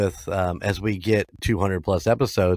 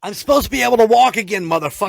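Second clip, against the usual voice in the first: high, loud, fast indignant complaint; mock angry rant; 12 semitones higher, 6 dB louder, much faster than usual. Ghost protesting to the card handler, fast high mock fury.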